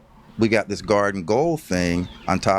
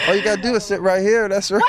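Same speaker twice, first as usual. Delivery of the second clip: playful coaxing; 12 semitones higher, faster than usual. Real, not acted. Coaxing grandfather into the seat.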